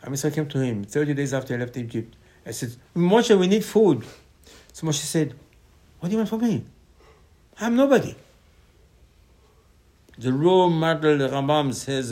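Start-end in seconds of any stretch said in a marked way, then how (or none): 3.0-4.0 loud, high, drawn-out complaint; acted demand. The people come to Moshe for food, loudly complaining. 6.0-8.1 high-pitched disclaiming; acted humble protest. Moshe answers the people who want food, protesting humbly.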